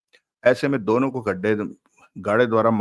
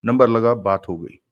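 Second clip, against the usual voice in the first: usual speaking voice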